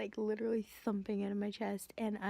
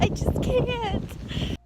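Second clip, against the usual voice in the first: much higher and very slow, loud; drawn-out overwhelmed wail; 12 semitones higher, 8 dB louder, much slower than usual. Real, not acted. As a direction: stretch every word out in helpless delight. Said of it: "I can't handle these feels".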